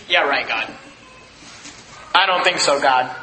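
low, sarcastic scoff; scoffing doubt. Acted. Abraham scoffing at God, in a low sarcastic voice. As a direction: drop the voice low and scoff at the promise.

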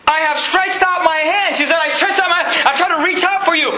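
high, pleading pulpit cry; full-force preaching. Acted. Preacher voicing Wisdom begging people to turn, full-force preaching.

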